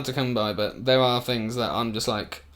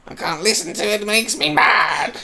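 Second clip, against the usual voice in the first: over-the-top exasperated cry; mock outburst; 8 semitones higher, 5 dB louder than usual. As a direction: whine it loudly, hamming up the outrage. Mock outburst about her music.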